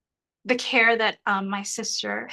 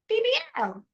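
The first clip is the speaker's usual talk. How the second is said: squeaky emphasis; 13 semitones higher than usual. Real, not acted. High exaggerated emphasis.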